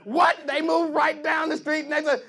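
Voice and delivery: normal talking voice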